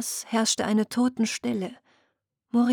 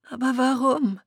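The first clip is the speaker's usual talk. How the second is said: breathed question; 4 semitones higher than usual. Acted. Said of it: "hauchte ein junger Selkie".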